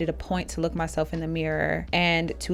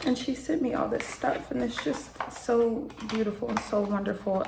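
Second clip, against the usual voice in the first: teary; 3 semitones higher, 4 dB quieter than usual. Real, not acted. Tearful over gift.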